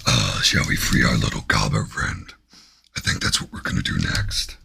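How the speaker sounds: very low, rasping demon voice; deep demon growl, often breathy. Pretend. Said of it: "Five minutes of my demon voice".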